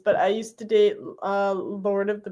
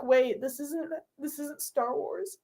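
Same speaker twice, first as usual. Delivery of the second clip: softer, puzzled questioning; confused mutter; 3 semitones higher, 8 dB quieter than usual. Pretend. Ryan puzzled over the gift, muttering softly. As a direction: Ryan realizing the box is wrong. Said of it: "And he'd be like".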